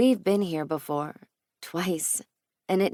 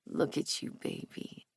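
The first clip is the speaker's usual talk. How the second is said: hushed murmur; 2 semitones lower, 12 dB quieter than usual. A narrator's reading. Lover murmuring into her hair, hushed near-whisper. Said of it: "he says against my hair".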